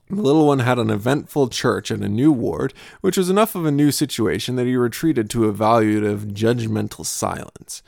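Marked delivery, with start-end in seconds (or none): none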